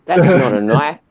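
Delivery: put-on heavy Australian accent; Crocodile Dundee. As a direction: quote it in a broad Australian accent. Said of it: "like Crocodile Dundee kind of Australian".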